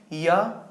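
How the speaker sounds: "या" with tongue nearing but not touching the palate; semivowel ya. Shown as a demonstration. Teacher showing the near-contact to students, semivowel "या". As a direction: demonstrate an isolated "या". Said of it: "सटने की कोशिश कर रहा है".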